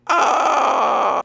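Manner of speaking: high drawn-out "ah"; hammed-up labour wail; mock pain cry.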